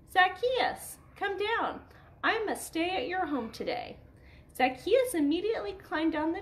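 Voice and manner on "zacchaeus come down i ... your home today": calling up. Acted. Jesus calling up to tree.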